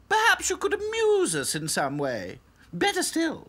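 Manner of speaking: high haughty doll voice; snooty little princess. A narrator's reading. Princess dolls.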